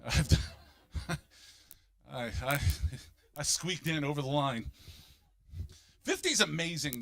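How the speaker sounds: normal talking voice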